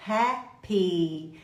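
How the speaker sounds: "happy" split in two, the final ee held; carefully finished Y. Shown as a demonstration. Teacher urging care on the Y, "happy" as hap-pee. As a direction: break "happy" apart and linger on the ee. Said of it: "Capricha no Y".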